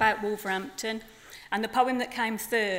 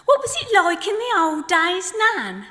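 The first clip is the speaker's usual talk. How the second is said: higher, louder chirpy chick voice; baby flamingo; 9 semitones higher, 7 dB louder than usual. Acted. Baby flamingo pestering his nan, chirpy and high. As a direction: pipe up like an eager little chick. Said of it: "in the voice of two flamingos".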